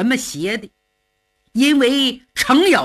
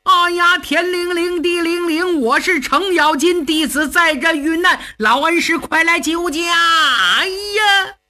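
high, hammed-up chant calling on the master; mock incantation shout; 6 semitones higher than usual. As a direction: wail the spell up to the clouds. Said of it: "我喊三声".

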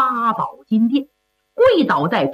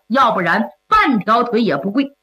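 usual speaking voice

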